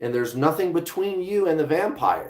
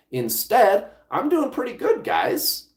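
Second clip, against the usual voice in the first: high, pleased, showing off; a squeaky, much higher boast to the viewers; pleased with himself, boasting to the viewers; playful brag; 10 semitones higher than usual. Real, not acted. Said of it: "bragging playfully".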